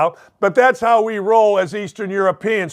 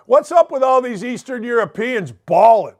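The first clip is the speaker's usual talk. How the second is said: ordinary talk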